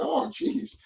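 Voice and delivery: hearing bad news from the roofer; high-pitched comic dismay; dismayed oh geez. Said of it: "And I go".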